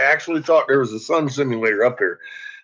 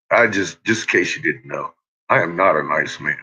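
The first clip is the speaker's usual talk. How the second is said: normal talking voice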